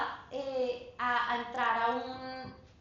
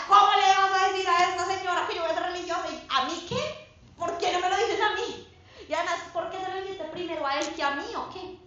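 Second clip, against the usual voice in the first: fast, loud indignant outburst at God; demanding to know why, half-joking; indignant complaint; 5 semitones higher, 6 dB louder than usual. Retold or imitated. A young woman scolding God, loud indignant complaint. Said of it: "Y yo le decía".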